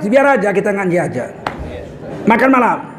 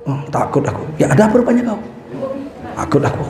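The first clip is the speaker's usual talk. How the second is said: low and soft fearful voice; a frightened drunk's low mutter; scared mutter; 4 semitones lower, 6 dB quieter, faster than usual. Retold or imitated. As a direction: say it cowering.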